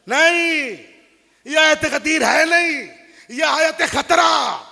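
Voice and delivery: preaching at full force. Acted. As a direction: thunder it at the mourners.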